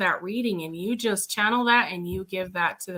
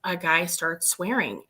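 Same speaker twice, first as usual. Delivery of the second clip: normal talking voice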